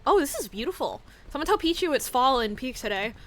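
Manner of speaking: normal talking voice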